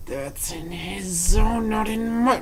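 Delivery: very slow, strained hesitant mumble; awkward objection creeping upward; held-back protest. Retold or imitated. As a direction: drag it out as if afraid to say it.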